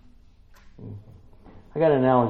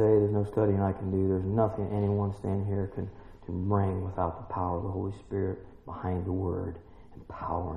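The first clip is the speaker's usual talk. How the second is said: lower, softer, humble prayer voice; 4 semitones lower, 4 dB quieter than usual. Acted. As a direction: pray quietly and reverently to the Father. Leads the closing prayer.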